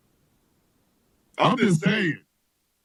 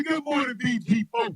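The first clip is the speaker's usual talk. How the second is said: ordinary delivery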